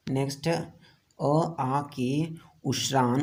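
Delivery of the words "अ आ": अ and आ drawn out slowly; teacher sounding out vowels slowly. Shown as a demonstration. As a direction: stretch अ, आ for the class. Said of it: "बोल के देखिए अ और आ".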